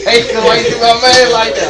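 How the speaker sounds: laughing, teasing a friend; laughing tease. Real, not acted. Friend teasing a barber, laughing. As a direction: tease him through real laughter.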